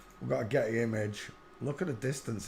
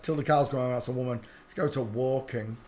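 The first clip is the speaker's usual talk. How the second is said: ordinary talk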